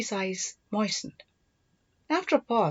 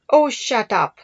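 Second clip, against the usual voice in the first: playful retort; 3 semitones higher, 7 dB louder, slower than usual. Acted. Sister teased by brother.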